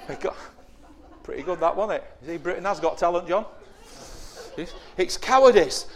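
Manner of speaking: ordinary talk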